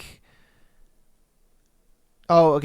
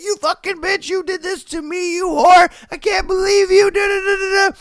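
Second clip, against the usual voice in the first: much higher, screeching curse that gets louder; enraged husband; 6 dB louder than usual. Retold or imitated. Dying husband cursing out his poisoner wife, high furious shout. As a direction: spit the curses at her with dying fury. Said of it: "And he's like".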